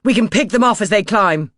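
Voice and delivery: loud, high battle call; rally the men to shoot; urgent shout. A narrator's reading. Crompton urging the crew to shoot climbers, loud shout.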